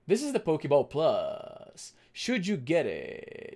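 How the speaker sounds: slow tech-reviewer voice, lingering on last syllables with vocal fry; Austin Evans impression. Streamer imitating a tech YouTuber's review voice, slow and fried. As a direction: stretch each phrase end into a fry. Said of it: "he says things like".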